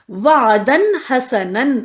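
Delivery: وعدن حسنن slowly, both tanweens clear; slow recitation. Shown as a demonstration. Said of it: "زبر کی تنوین اپنے ساتھ الف". (آہستہ تنوین کے ساتھ)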